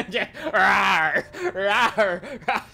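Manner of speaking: laughing mock roars; playful roar. Player recalling annoyed mammoths, laughing mock roars. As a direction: roar in play through laughter.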